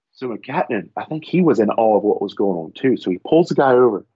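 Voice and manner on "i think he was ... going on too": ordinary delivery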